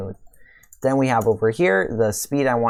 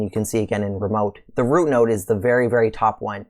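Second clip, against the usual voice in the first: ordinary delivery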